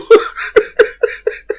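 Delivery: shrill breathless laugh; high squealing laugh. Real, not acted. Laughing at the reunion.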